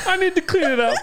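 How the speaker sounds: high, loud weepy squeal through laughter; hammed-up tearful plea, barely holding back laughs; mock crying. Co-host mimics her crying over the prize, mock crying. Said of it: "You're like".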